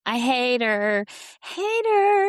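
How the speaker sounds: drawn-out words rising to a high, loud pitch; emphatic loathing. Real, not acted. Co-host venting about a cast member, emphatic loathing. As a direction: stretch it out, climbing into a high wail.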